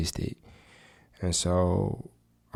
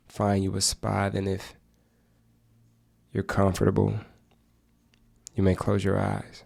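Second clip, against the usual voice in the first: unhurried meditation guide, long pauses; measured, even meditation voice with spacious silences; guided meditation. Acted. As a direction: guide listeners gently into stillness, phrase by phrase.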